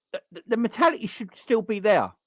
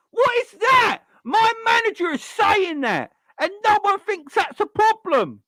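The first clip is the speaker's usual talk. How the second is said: higher and louder, fed-up yell; exasperated shout; 10 semitones higher, 4 dB louder than usual. Real, not acted. Fan shouting at his club's manager, exasperated yell. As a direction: let the frustration burst out.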